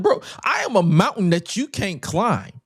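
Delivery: mock the promo, high and grand; hyped promo voice. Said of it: "he didn't say it like that".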